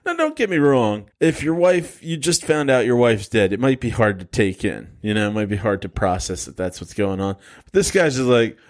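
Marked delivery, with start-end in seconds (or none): none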